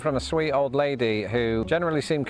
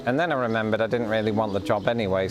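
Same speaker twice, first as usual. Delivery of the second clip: ordinary talk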